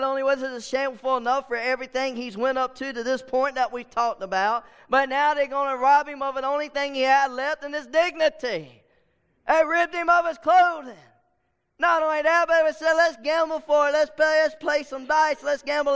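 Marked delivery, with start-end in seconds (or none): 4.9-15.9 higher, louder preaching shout with mocking soldier quotes; full-force preaching. Real, not acted. Preacher voicing the soldiers' gambling, full-force preaching. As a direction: drive it home to the congregation.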